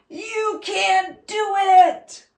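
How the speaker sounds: high, loud, slow taunting yell. A narrator's reading. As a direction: yell it to crush his hope. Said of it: "They yelled".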